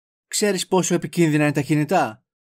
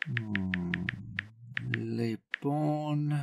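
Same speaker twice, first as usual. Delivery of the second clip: very soft, low hum; absent-minded searching; 5 semitones lower, 13 dB quieter, much slower than usual. Acted. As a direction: murmur to yourself while looking something up. Searching his phone contacts. (χαμηλόφωνο μουρμουρητό αφηρημένης αναζήτησης)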